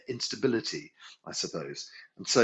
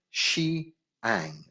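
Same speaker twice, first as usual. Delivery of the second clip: very slow drawn-out Shi Ang; slowly spelled out. Shown as a demonstration. Teacher pronouncing the middle tone name slowly for students. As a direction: stretch each syllable so they hear it. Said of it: "The middle ta-, tone".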